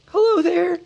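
high, shaky hobbit calling out. A narrator's reading. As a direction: call out weakly with a wobble. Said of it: "he called with a shaky voice".

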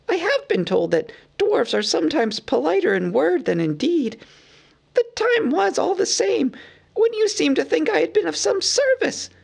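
very high, fussy hobbit voice; Bilbo. A narrator's reading. Bilbo protests to Thorin in a high, fussy voice. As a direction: chirp it like a flustered hobbit.

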